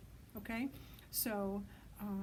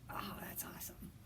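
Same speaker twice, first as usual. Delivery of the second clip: whispered praise; 7 dB quieter than usual. Real, not acted. Praising kids after hooting.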